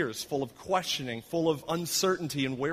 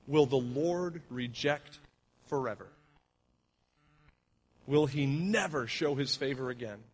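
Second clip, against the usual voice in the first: scripture reading. Acted. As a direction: read the lament as a heavy, grieving prayer. Continues reading the psalm.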